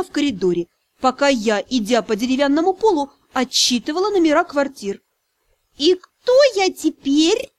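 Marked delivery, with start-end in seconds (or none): 5.8-7.5 high, slow furious dragon spirit; seething shrieking spirit. Acted. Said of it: "разъярённо поинтересовался он".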